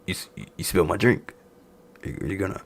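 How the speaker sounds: soft, halting imitation of a grumble; mock muted complaint. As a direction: drop the voice and stammer the complaint. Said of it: "he just talking like this".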